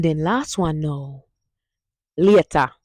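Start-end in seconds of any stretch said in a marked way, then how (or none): 2.2-2.7 "later" said as "lieta", loud and falling; Patois goodbye. Shown as a demonstration. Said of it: "Hear that?".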